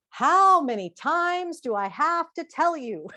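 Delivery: loud, slow, exasperated scolding; fed-up mum scolding her kid; exasperated parent. Acted. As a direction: drag out "How many times" like a fed-up parent.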